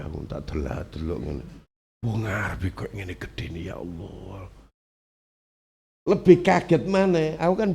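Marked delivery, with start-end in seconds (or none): 0.0-4.5 awed hush. Retold or imitated.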